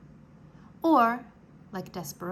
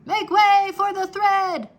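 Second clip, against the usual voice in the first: crying out; 9 semitones higher, 12 dB louder than usual. Acted. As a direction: bellow it to clear the way. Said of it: "cried a mouse".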